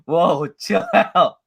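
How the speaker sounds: slow, drawn-out whoa; calm down. Retold or imitated. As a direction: soothe an overexcited friend. Calming a friend, retold.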